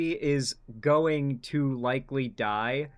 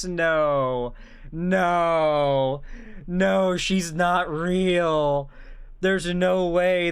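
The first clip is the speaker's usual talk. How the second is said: loud disbelief; 4 semitones higher, 6 dB louder, much slower than usual. Real, not acted. Shocked at the clip's necklace.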